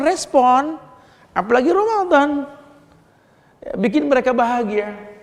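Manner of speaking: high, rising call to act; urgent preaching. Acted. Preacher urging listeners to help poor neighbours, high and pressing. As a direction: press them to respond, with fire.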